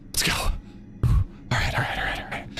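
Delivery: whispered pep talk to herself; nervous whisper. Real, not acted. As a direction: breathe the words out to calm down. Psyching up at the edge.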